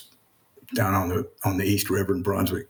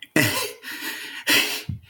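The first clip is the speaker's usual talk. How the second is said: airy unvoiced laugh, half-overcome; breathless laugh; 5 semitones higher than usual. Real, not acted. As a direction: let out a breathy emotional laugh. Emotional laugh after his remark.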